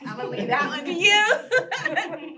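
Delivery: laughing loudly while slowing down; big laughing handoff. Real, not acted.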